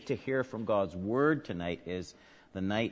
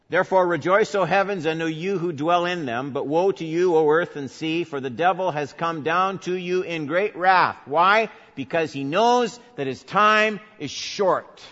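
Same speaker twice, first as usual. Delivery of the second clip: raised, loud reading of Revelation; proclaiming scripture; 7 semitones higher, 8 dB louder than usual. Acted. Preacher reading Revelation aloud to the church, loud and raised. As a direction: proclaim the verse like a herald.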